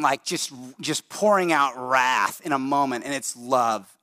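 ordinary talk, nothing marked

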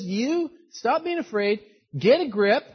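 high, emphatic mock scolding; acted telling-off in punchy short bursts; exasperated Moses. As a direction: order them to get hold of themselves. Moses acted, ordering them to calm down.

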